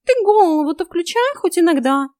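high, loud cat rebuke; scolding cat. A narrator's reading. Cat tells heroine to think, loud scolding. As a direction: scold at full pitch, exasperated.

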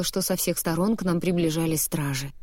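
usual speaking voice

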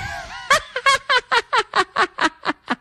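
high mocking cackle; high sneering devil laugh; devil's cackle. Devil laughs after the jeer.

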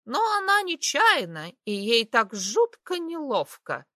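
high squeaky mock woman's voice; squeaky fake female voice. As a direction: squeak it like a silly girl. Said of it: "продолжил писклявым голосом, имитирующим женский". (писклявый голос, имитирующий женский)

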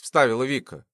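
usual speaking voice

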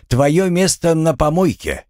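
high, admonishing verdict; lecturing. Acted. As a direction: close a lecture with a firm reprimand. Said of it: "закончил поучительную речь хранитель". (поучительно, повысив голос)

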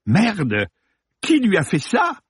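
high-pitched appalled question; shocked. Acted. Actor reacting to a murder victim's photo, shocked. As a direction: let the voice leap up in dismay.

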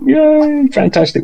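high delighted yay; excited cheer. Acted. Celebrating a win.

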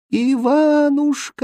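high, long-drawn call from far away; acted desperate far-off wail; fading distant cry. A narrator's reading. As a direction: call his name, stretched and dying away. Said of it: "издали раздался затихающий крик".

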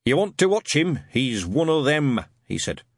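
put-on a suspicious old witchfinder ranting; gruff old sergeant. A narrator's reading.

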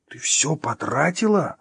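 high, slow incredulous question; baffled. Acted. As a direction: ask it slowly, thoroughly puzzled. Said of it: "озадаченно поднял брови Артём".

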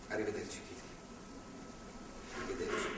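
hushed parting; soft farewell. Acted. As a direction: let the goodbye fade to a whisper.

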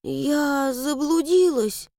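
high, slow, scared little voice; frightened. Acted. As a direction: answer timidly in fear. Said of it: "испуганно ответила Мила".

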